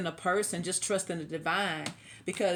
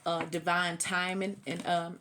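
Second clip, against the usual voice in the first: usual speaking voice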